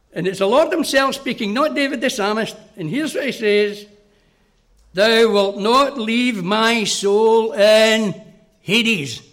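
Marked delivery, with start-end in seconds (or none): 4.9-9.2 solemn scripture reading. Acted. Quoting Psalm sixteen slowly.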